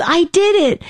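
high, proud cry of success; a boy announcing his win; triumphant kid. Retold or imitated. Son bursts through door.

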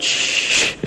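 soft unvoiced shush; rhythmic shushing. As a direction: hush a grieving child. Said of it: "and she said".

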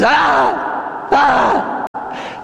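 two high yelled cries; acted cry of pain. Jesus crying as he's beaten, acted pained cries. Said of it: "and he cries".

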